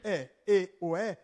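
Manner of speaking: ordinary talk, nothing marked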